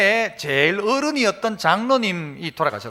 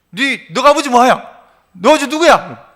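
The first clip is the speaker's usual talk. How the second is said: louder mock-angry interrogation; retold scolding; 3 semitones higher, 7 dB louder than usual. Driver demanding to know the child's father, retold angry scolding. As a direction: act out a driver berating a child. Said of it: "막 야단을 치니까".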